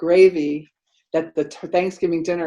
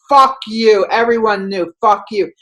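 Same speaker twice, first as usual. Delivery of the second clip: louder, heated cursing, repeated; angry cursing; 5 semitones higher, 6 dB louder than usual. Retold or imitated. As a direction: spit the curses back at him. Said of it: "He's like".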